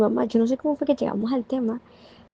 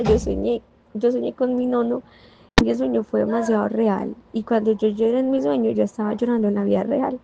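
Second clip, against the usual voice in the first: ordinary delivery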